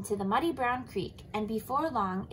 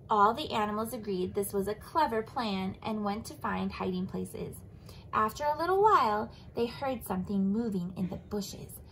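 usual speaking voice

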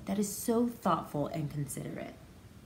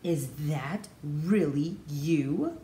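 amazed disbelief; 4 semitones lower, much slower than usual. A narrator's reading. Disbelieving reunion question.

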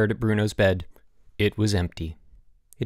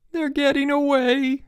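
high, drawn-out wail. A narrator's reading. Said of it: "Elmer wailed".

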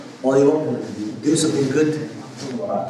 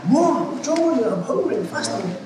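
greedy customer; 11 semitones higher than usual. Retold or imitated. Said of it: "He goes".

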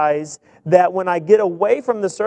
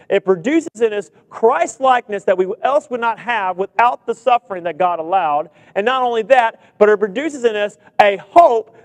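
loud, building sermon climax; preaching at full force; 4 semitones higher, 5 dB louder than usual. Acted. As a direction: drive the congregation to hope.

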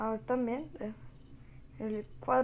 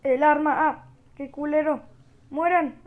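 loud, higher frantic gamer yells; battle shouting; 4 semitones higher, 8 dB louder than usual. Pretend. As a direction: shout at the enemies mid-fight. Fighting enemies, about to die.

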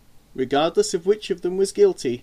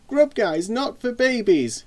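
higher, slow and emphatic protest; insistent; 8 semitones higher, 4 dB louder, slower than usual. A narrator's reading. Boy defending his superhero to his sister, insistent. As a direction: stand up for your hero, drawn out. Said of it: "insisted Simon".

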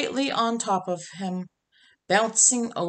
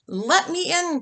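cried out; 9 semitones higher than usual. Acted. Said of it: "cried Dog at the door".

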